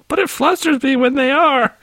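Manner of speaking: very high squeaky otter voice. Acted. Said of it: "Otter Voice".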